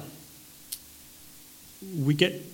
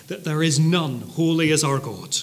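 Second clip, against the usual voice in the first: normal talking voice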